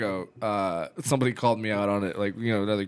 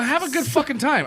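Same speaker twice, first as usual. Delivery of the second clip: high, frustrated retold shout at the audience; exasperated outburst; 14 semitones higher, 3 dB louder than usual. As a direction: blow up at a dead crowd. Retelling snapping at bored crowd.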